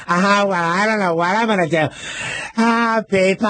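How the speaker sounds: high, brassy showbiz diva voice; Carol Channing impression. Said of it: "She went".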